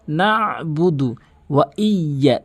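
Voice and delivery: "নাবুদু" with squeezed ain, "ইয়া" with held double y; pressed ain. Shown as a demonstration. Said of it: "চাপ দিয়ে বলতে হবে". (চাপ দিয়ে "নাবুদু ওয়া ইয়া")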